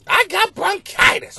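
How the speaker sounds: high imitation of the interview lady; viral lady impression. Comic repeating the viral clip, high impression. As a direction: echo the viral lady's cry.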